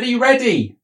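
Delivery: high repeated call across the house. Acted. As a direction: shout the question again. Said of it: "I said".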